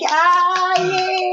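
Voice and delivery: high squeal of joy; a giddy high little cheer; mock delight. Fan reacting to the reunion, high and giddy. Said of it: "I'm like".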